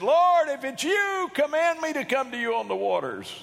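high, loud call across the water; Simon Peter calling out. Acted. Preacher as a disciple answering Jesus from the boat, calling out. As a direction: call it out high, easing down toward the end. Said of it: "Simon Peter answered and said".

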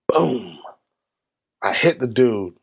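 usual speaking voice

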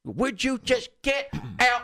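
high, slow exasperated plea; high drawn-out fed-up plea; exasperated trucker. Retold or imitated. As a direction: beg the bird to leave, fed up. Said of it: "And he opens that door".

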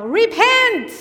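high, loud fire-and-brimstone cry; preacher's yell. Retold or imitated.

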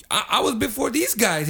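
high-pitched mock outrage; retold indignant protest. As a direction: insist on your turn, acting it out. Retold quarrel over being served.

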